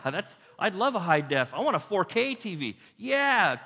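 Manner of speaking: hammed-up pushy salesman; pushy tempter. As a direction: sell him the TV excitedly. Tempters pushing flat screen TV.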